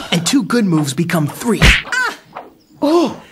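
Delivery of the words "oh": sharp, high, loud gasp of triumph; sudden loud oh; surprised exclaim. Acted. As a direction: blurt it out as the hit lands. Narrator recalls winning blow.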